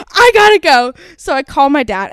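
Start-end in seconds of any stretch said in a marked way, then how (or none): 0.1-0.9 high, loud mock urgency; retold fluster. Hanging up on friend to call dad, flustered. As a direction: blurt it as if cutting a call short.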